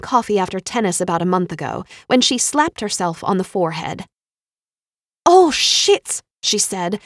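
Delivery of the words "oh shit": put-on loud high Swedish-accented curse; Ingrid's Swedish accent. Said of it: "in the Swedish accent".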